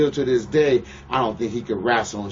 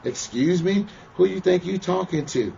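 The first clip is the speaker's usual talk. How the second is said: higher, rising indignant outrage; retold outburst; 8 semitones higher than usual. Retelling the plane fight to the interviewer, rising outraged comeback. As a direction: fire back at the rude man, higher and higher. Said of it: "I just basically went off".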